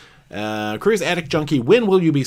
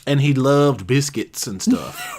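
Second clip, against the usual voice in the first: mock Southern accent; 6 semitones higher, 3 dB louder than usual. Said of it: "unless you pretend to".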